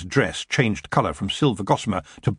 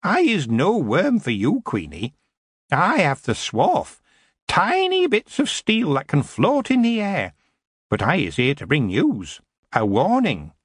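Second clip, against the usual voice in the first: higher, rough goblin voice, ungrammatical and cocky; cheeky goblin; 8 semitones higher than usual. A narrator's reading. Goblin prisoner cheeking the queen, cocky goblin voice. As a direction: grin while you talk back.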